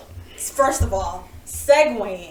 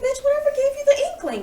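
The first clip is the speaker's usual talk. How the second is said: very high-pitched mock disbelief; incredulous comeback. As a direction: retell it as a high, sassy retort. Retold incredulous comeback to caller.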